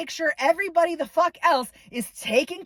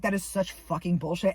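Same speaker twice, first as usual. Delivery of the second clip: ordinary talk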